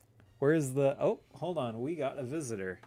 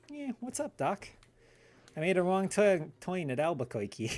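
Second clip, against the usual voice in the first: higher cartoon rabbit voice, hammed up; mock the usual Bugs Bunny bit; 7 semitones higher than usual. Mocking what everyone does for Bugs Bunny, hammed-up rabbit voice. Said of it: "They just say".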